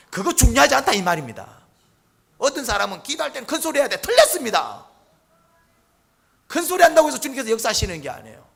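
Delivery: loud, high, emphatic correction; full-force preaching. Real, not acted. Preacher correcting believers on how to pray, full-force preaching. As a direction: hammer the point home to the congregation.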